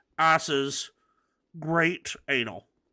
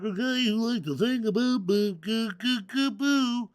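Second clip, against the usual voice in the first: mocking singer voice; 7 semitones higher, slower than usual.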